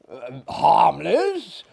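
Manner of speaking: slow, puzzled echo of the word; unfeigned surprise. Acted. Maillard taken aback by his guest, slow surprised echo. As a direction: repeat it as if astonished. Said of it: "ejaculated he in unfeigned surprise".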